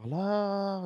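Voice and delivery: high, long-held calling hello; mock yell out into the storm; mild retold yell. Dreamer calling to whoever is crying, mild mock yell. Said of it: "I'm yelling".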